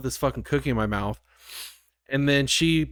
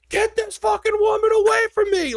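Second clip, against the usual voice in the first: very high, loud mock scream; panicked shout; 6 dB louder than usual. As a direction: hysterical comic yell for help. Terrified reaction to child, retold.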